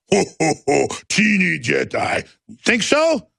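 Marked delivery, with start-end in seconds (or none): 0.1-2.3 a hint of a gloating space slug; mild mock Jabba, taunting a critic; Jabba the Hutt. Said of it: "Like your Jabba the Hutt".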